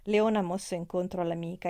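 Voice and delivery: ordinary delivery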